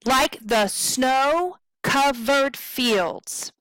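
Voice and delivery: slow scansion, SNOW and FIELDS drawn long; short-short-long rhythm. Shown as a demonstration. Teacher scanning the line, long stresses on SNOW and FIELDS. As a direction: chant "like the SNOW, covered FIELDS" in threes. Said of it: "So if we look at this, we have".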